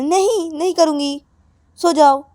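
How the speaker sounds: high, firm, huffy voice; cross refusal. Acted. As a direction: refuse and order them to bed. Acted refusal to forgive. (नाराज़ होकर साफ़ इनकार)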